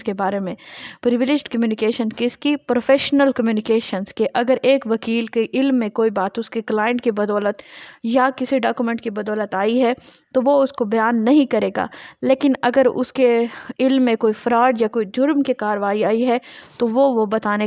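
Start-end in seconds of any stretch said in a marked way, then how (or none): none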